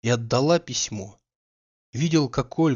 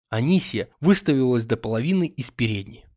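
plain reading voice

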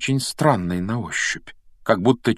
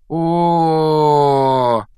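drawn-out "oh" groan, high and loud; long dismayed groan; 6 semitones higher, 5 dB louder than usual. A narrator's reading. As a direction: groan aloud as the awful truth dawns. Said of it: "простонал он".

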